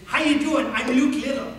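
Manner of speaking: high, loud mock-eager greeting; boisterous introduction. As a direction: imitate an overeager kid introducing himself. Acting Luke's boisterous introduction.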